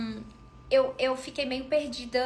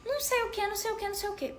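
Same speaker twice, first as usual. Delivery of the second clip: mock ranting; 9 semitones higher, much faster than usual.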